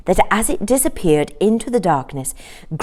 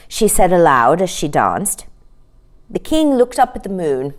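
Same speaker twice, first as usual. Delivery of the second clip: plain reading voice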